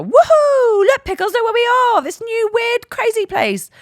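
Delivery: high, loud mock woohoo excitement; forced adventure cheer. Mum selling a grim stop to her baby as an adventure, forced cheer. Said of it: "we're gonna make this another adventure".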